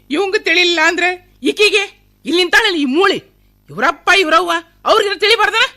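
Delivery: loud, high-pitched tirade; angry scolding. Acted. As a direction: lash out at the bride and her family. Mother berates bride and her parents. (ಸಿಟ್ಟಿನಿಂದ ಜೋರಾಗಿ ಬೈಯುವುದು)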